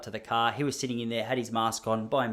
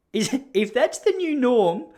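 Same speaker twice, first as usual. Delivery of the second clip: indignant rant; 10 semitones higher, 7 dB louder, slower than usual. Real, not acted. Ranting about pandemic rules.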